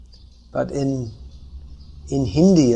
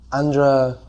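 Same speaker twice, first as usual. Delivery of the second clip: ordinary delivery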